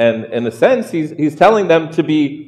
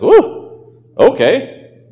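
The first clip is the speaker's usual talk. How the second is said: deflated reaction; 8 semitones higher, much slower than usual. Acted. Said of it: "you're all excited, and then".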